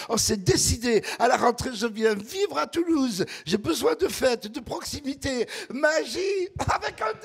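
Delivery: imitating her high, pleading plea; pleading girl. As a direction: beg like an eager tourist. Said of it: "elle harcelait de plus belle". (imiter la jeune femme suppliante, voix aiguë)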